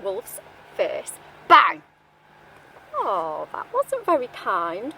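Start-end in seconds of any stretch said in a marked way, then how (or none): 1.5-1.8 loud door slam sound; loud bang effect. Acted. Door slammed in story.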